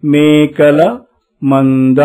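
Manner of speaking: teacher drawing out మేకల మంద; slow model reading. Shown as a demonstration. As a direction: read syllable by syllable for the class. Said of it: "మళ్ళీ అనండి".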